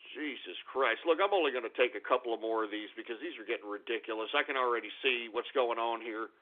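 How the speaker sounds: low, soft, fed-up grumble; deflated, low-voiced irritation; weary exasperation. Real, not acted. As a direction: drop down quiet and tired of it. Fed up with the names.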